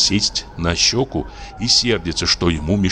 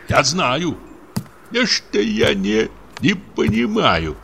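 high, slow, agitated mutter; voiced mutter; 9 semitones higher, slower than usual. A narrator's reading. Husband mutters at his wife, high and slow. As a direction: mumble it high and dragging. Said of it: "забормотал он".

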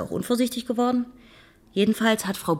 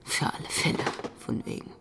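unvoiced whispered scoff under the breath; scornful mutter; 6 semitones lower, 7 dB quieter than usual. Detective scoffing to himself after the call, whispered. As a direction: hiss it to yourself, mocking the advice. Mocking the caller after hanging up.

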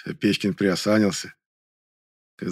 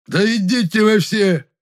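put-on high, slow drunken roar; drunk angry yell; 11 semitones higher, 4 dB louder, slower than usual. A narrator's reading. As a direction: bellow it drunk and furious. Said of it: "заорал пьяным злым голосом". (пьяный злой крик)

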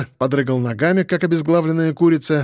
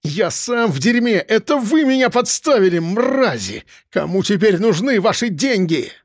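raised-pitch bitter accusation; near-squealing outburst; 6 semitones higher than usual. A narrator's reading. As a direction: snarl blame in a high, agitated voice. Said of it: "почти что взвизгнул Ананас".

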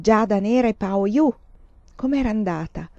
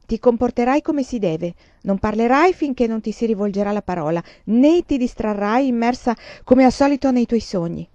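louder, higher stern lecture; mother lecturing daughter on manners, stern; 4 semitones higher, 6 dB louder than usual. Acted. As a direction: tick off every rule.

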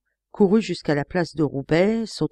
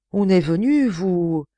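slow, halting, stunned repetition; stammering; 3 semitones higher, 4 dB louder, slower than usual. A narrator's reading. Lecoq stunned by the news, slow halting stammer. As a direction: stumble over the words in disbelief. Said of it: "balbutia Lecoq".

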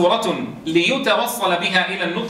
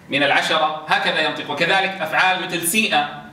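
ordinary talk

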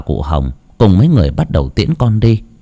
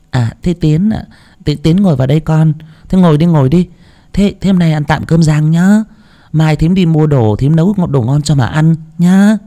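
gentle; 7 semitones higher than usual. A narrator's reading. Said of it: "giọng lại nhẹ nhàng".

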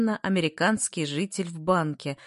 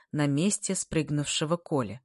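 ordinary delivery, nothing marked

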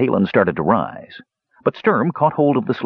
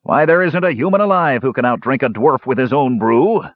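higher, mildly roared dwarf boast; roaring dwarf; 6 semitones higher, 3 dB louder than usual. A narrator's reading. Dwarf to human drinkers, roaring his boast. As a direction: protest with indignant pride. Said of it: "the dwarf roared so loudly".

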